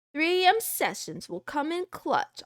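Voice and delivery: ordinary delivery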